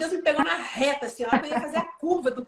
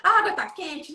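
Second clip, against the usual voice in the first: put-on child protesting; 6 semitones higher, 5 dB louder than usual. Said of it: "daughter crying refusal".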